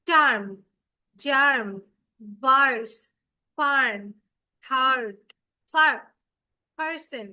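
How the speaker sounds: টার্ম, জার্ম, ভার্স said one by one with an "ar" vowel, no "e"; r-controlled demo words. Shown as a demonstration. Teacher demonstrating word list to the class, loud and clear. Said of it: "দেখো তো এখানে কি আমি ইটাকে ই এর মতো উচ্চারণ করলাম?".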